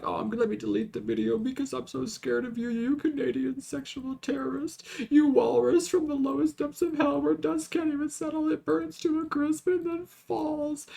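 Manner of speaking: high, put-on mock-scared voice; mocking fake fear. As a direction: sneer it in a fake frightened squeak. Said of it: "I would be like".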